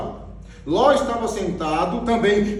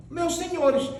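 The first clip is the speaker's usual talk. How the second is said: higher softer repeat; 6 semitones higher, 3 dB quieter, slower than usual. Shown as a demonstration. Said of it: "Então veja aí a diferença".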